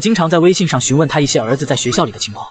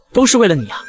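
ordinary delivery

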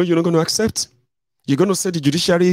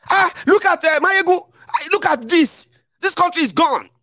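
very high, exasperated complaint; exasperated friend. Retold or imitated. Friend complaining to Mayegun about the country: very high, exasperated. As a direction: play the fed-up friend, pitched way up.

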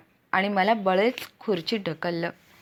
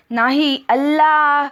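furious, high, full-voiced yell; shout from the gut; 6 semitones higher, 7 dB louder, much slower than usual. A narrator's reading. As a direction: bellow the demand with all your might. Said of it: "चौथ्यांदा बेंबीच्या देठापासून ओरडून म्हणाला". (बेंबीच्या देठापासून संतापून ओरडून)

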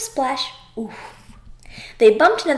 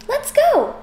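eager. Acted. Froggy eager to leave.

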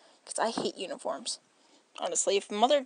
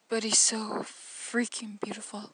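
slow, swooning whisper. Real, not acted. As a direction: breathe it out like a secret crush. Whispered swoon over character.

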